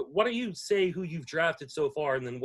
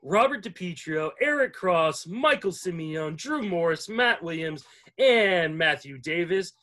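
roll-call of guests, announcer style; projected, ceremonial show welcome; podcast host announcement; 4 semitones higher, 6 dB louder, slower than usual. Acted. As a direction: introduce each guest like an emcee.

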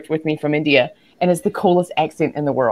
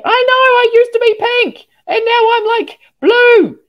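very high, loud mock protest; comic exasperated wail; 5 dB louder than usual. Coach replying to her colour therapist, squealing in mock despair.

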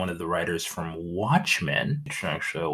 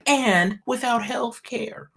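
mock Republican voice; 12 semitones higher, 5 dB louder than usual. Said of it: "'Cause they'd be like".

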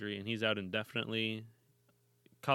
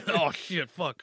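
higher, louder hammed-up cursing; mock yelling; 7 semitones higher, 8 dB louder, slower than usual. Coach reacting to a goal, hammed-up yell. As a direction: ham up a frustrated coach.

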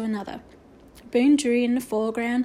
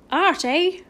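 raised, perky character voice; brightening up; 6 semitones higher, 4 dB louder than usual. A narrator's reading. Boone hearing that the class is art, brightening up. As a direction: perk up with sudden interest. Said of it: "brightening up".

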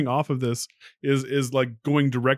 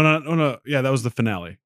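normal talking voice

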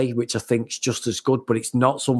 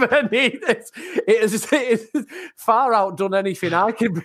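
laughing; 8 semitones higher than usual. Real, not acted.

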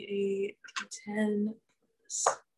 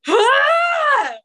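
long, high, very loud scream; sudden full-volume "aah"; 11 dB louder than usual. Pretend. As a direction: let out a sustained high shriek. Player screams at the table.

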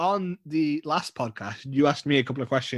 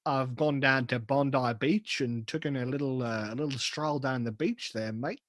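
softer, exaggerated Australian storytelling; broad but quieter mock Australian; Australian accent; 8 dB quieter than usual. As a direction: dial up the Aussie accent, rising on the last word. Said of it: "you're dialing it up".

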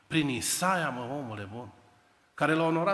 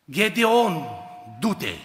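loud, raised command; commanding call; 8 dB louder, much slower than usual. Acted. God sending Gedeon off, loud command. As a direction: call him out and send him.

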